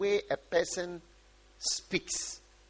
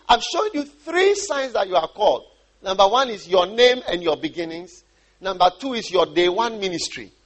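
ordinary talk

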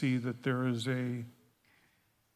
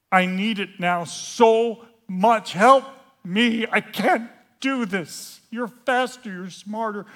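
raised, slow cry for help; desperate plea; 5 semitones higher, 9 dB louder, slower than usual. Acted. Begging God for help, desperate and loud. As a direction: plead loudly, pitch pushed up.

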